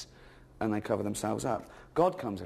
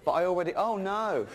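mock dismay; 7 semitones higher, 3 dB louder than usual. Snake voiced.

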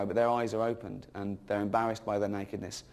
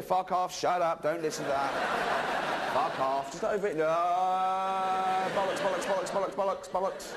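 raised, rapid dismissive heckle; heckling God; 7 semitones higher than usual. Acted. God shouting down the snake, raised jeering heckle. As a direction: drown the snake out with abuse.

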